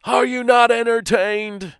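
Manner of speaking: high, loud arena roar; movie yell. Retold or imitated. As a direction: shout it to the silent crowd like a gladiator. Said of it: "And he yells".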